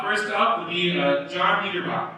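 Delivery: ordinary delivery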